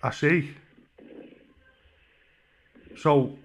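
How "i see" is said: ordinary talk, nothing marked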